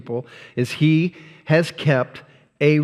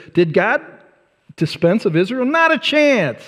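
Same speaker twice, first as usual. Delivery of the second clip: raised pitch, landing hard on "Not a chance"; emphatic retort; 7 semitones higher than usual. Retold or imitated. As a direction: toss off the answer with a high punch. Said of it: "We might say it like this".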